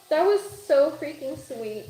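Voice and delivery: usual speaking voice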